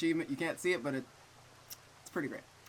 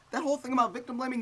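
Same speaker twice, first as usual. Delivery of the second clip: fast outrage; 11 semitones higher, 7 dB louder, faster than usual. Real, not acted.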